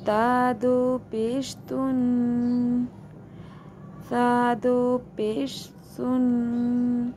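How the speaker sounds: slow drawn-out spelling drill; recitation drill. Shown as a demonstration. Quran teacher drilling students through the letters slowly. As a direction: stretch each syllable with nasal ghunna.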